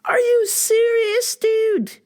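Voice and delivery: very high, drawn-out mock disbelief; incredulous. Adult child answering dad, high-pitched comic incredulity. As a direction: squeal it in exaggerated disbelief.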